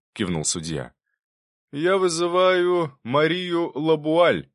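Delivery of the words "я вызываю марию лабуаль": slow, loud, raised courtroom call; loud announcement. A narrator's reading. Prosecutor calls the witness loudly. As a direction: announce the witness to the whole hall. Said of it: "громко сказал Прокудин".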